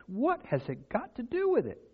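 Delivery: high, soft mock puzzlement; ironic questioning. Shown as a demonstration. Preacher questioning baptism's role, high-pitched irony. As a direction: pitch it way up in feigned wonder. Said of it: "the irony of what I'm saying".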